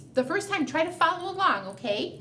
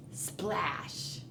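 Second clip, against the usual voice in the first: whispered splash; 7 semitones lower, 9 dB quieter than usual. Acted. Hushed sound effect in story.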